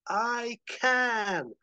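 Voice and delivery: high, stretched defiant scream; screaming back. Acted. Howard screams back at Chet in the rift, defiant scream. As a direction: scream back, holding each word. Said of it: "And Howard screams back".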